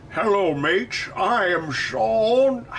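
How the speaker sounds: put-on failed Australian and Scottish accent mash-up; Sean Connery. Streamer tries a requested impression, hesitant Australian-Scottish accent. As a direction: attempt an Australian Scotsman, drawn out at the end. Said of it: "Australian Sean Connery?".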